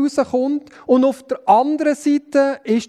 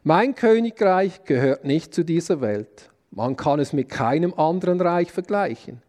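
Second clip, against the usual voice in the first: measured, lower reading voice for the Passion text; scripture reading; 7 semitones lower than usual. Acted. Preacher reading the trial before Pilate to the congregation, solemn scripture reading. Reads the Passion account aloud.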